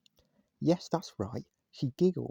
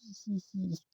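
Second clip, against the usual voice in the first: bright high-pitched giggle; 9 semitones higher, 3 dB quieter than usual. A narrator's reading. Little Miss Giggles laughing in front of the manager, a high giggle. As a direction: let a little girl's giggle bubble out. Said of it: "giggled Little Miss Giggles".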